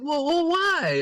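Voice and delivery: high, drawn-out mock bewilderment; baffled. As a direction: stretch out a squeaky, incredulous why. Retold baffled comeback to sister.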